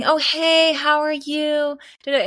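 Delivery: high, slow, syrupy greeting; oil it on like a snake charmer; mock-charming agent on the phone; imitating a smooth charmer. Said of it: "kind of snake charm about him".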